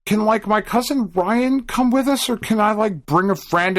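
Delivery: put-on high, whiny begging child; pleading kid. Boy begging his parents to bring a friend, high and whiny. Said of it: "I was like".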